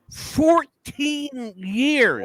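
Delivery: "Fourteen years" stretched very slowly, pitched high then falling; high, long-held "Fourteen years"; drawn-out emphasis. Real, not acted. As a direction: drag out "Fourteen years" to drive it home. Repeats the figure for emphasis.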